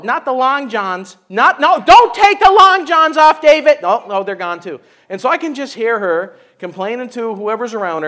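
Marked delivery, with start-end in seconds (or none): 0.1-3.8 building from a mutter to a loud, higher cry; mock shriek at David; alarmed plea. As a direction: panic rising as the last layer comes off. Wife pleading, rising alarm.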